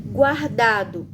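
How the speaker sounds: "Guardado" said slowly, gu-ar-da-do with the U sounded; teacher stretching "Guardado" to show the gu sound; slow demo word. Shown as a demonstration. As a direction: draw out "Guardado" so the G and U both ring. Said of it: "Veja que eu falo o som do G e o som do U".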